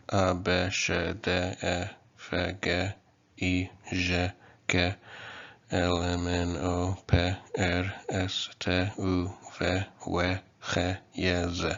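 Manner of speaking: letters voiced as Kotava sounds, pure vowels; approximated letter sounds. Shown as a demonstration. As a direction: recite C as sheh, J as zheh, X as kheh. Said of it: "I'm just approximating the sounds".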